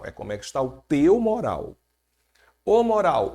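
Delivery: ordinary talk, nothing marked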